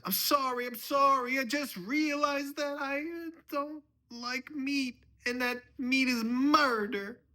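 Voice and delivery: loud, very high frantic pleading; panicked apology. Acted. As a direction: yell your apology in a hammed-up panic. Panicked son-in-law apologizes at dinner.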